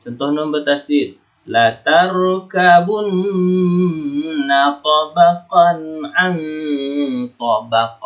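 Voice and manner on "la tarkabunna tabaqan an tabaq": slow recitation, droning long on the n of "tarkabunna" and "an"; gunnah. Shown as a demonstration. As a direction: hold the nn of "tarkabunna" in a long hum. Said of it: "maka dibaca apa? Gunnah".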